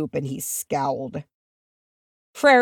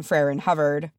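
usual speaking voice

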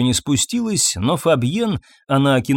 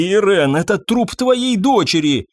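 high, appalled outcry; horrified protest; 7 semitones higher than usual. A narrator's reading. Husband faces the corpse of wife's daughter, horrified. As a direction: cry out in horror, pitch raised.

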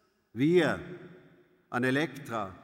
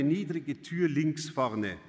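ordinary delivery, nothing marked

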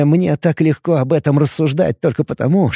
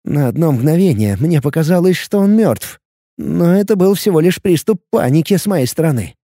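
ordinary delivery

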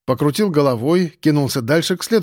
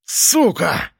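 roar; 3 semitones higher than usual. Acted. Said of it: "заревел он".

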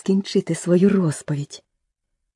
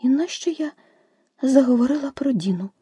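sad; 8 semitones higher than usual. A narrator's reading. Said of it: "сумно докоряла собі Аліса".

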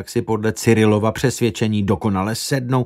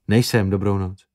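plain reading voice

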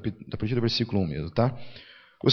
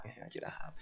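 whisper; 24 dB quieter than usual. Retold or imitated. Mother's weak reaction mimicked.

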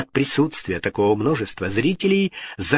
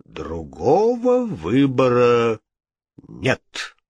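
solemn, important; much slower than usual. A narrator's reading. Ghost refusing the king, solemn. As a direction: pronounce it slowly and weightily. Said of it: "важно отвечал голос".

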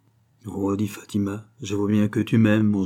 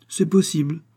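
higher, innocent-sounding reply; feigned naivety; 7 semitones higher, 3 dB louder than usual. A narrator's reading. Fatima fooling Don José about the drug, feigned naivety. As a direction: play innocent, lifting the pitch. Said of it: "avec une naïveté si bien jouée".

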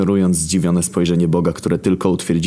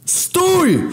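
desperate piercing yell, much higher; shout; 4 dB louder than usual. Acted. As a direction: scream to stop the blow. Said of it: "wrzasnąłem".

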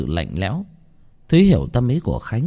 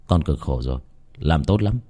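gentle praise; 5 semitones lower than usual. A narrator's reading. Master comforts ghost.

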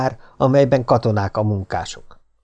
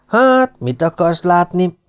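lordly, high-pitched opening word then offhand; condescending; 6 semitones higher, 3 dB louder, slower than usual. Acted. Big boy asking the newcomer loftily, condescending. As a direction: talk down to the new kid. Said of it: "kérdezte felsőbbségesen Svekla".

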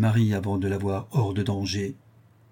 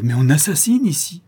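raised, higher and louder protest; alarmed exclamation; 6 semitones higher, 6 dB louder than usual. A narrator's reading. La Louve warns the doctor about the house, alarmed cry. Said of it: "s'écria la Louve".

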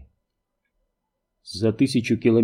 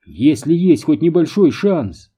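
higher-pitched, mildly heated protest; lashing out; 4 semitones higher, 4 dB louder than usual. A narrator's reading. Ruth rounding on her colleague over a slim chance, heated protest. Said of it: "Рут набросилась на коллегу".

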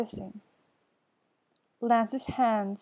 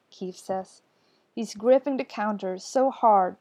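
ordinary delivery

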